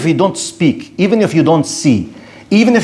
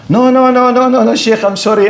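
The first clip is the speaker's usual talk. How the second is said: high, run-together string of refusals; mock protest; 7 semitones higher than usual. Humanist to the Sheikh about colonialism, high mock protest. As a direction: play the doubter backing down, voice pitched up. Acting the doubter backing off.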